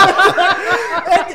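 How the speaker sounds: loud real laughter bursting out; loud laughing fit. Real, not acted. Friend cracks up loudly retelling a joke, real laughter. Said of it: "that joke stuck with me".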